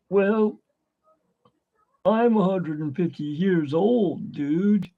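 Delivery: turtle dude. Acted. Turtle answering a little girl's question, slow dude voice. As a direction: draw it out like a laid-back dude. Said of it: "like, they'll ask".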